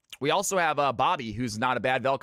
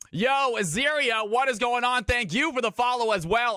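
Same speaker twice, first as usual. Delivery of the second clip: excited greeting; 8 semitones higher than usual. Real, not acted. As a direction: call out to a new follower with real energy. Greeting a viewer in chat.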